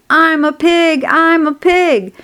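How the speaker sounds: high-pitched, showing-off holler; boy's yell. A narrator's reading. Boy playing pig in the crate, yelling. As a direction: crow it like a kid playing pig. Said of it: "yelled Avery, crawling on all fours".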